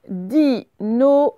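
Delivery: very slow, four separated beats; syllable by syllable. Shown as a demonstration. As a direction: sound it out for pupils. Correction, découpe le mot en syllabes.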